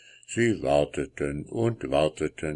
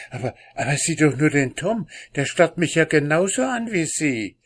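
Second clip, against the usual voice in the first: rushed and alarmed; 8 semitones higher, 3 dB louder, faster than usual. Acted. Gravedigger alarmed by the cat.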